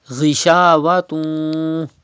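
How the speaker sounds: "Ghishawatun" ending in a long nasal hum. Shown as a demonstration. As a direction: hold the last syllable of "Ghishawatun" in the nose. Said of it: "Gunna will be done".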